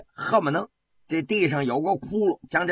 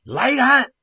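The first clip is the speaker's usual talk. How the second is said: much higher and louder call for the guards; shouted command; 12 semitones higher, 8 dB louder than usual. A narrator's reading. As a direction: yell for the guards to come. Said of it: "道光喊了一声". (皇帝高声喊人，怒吼)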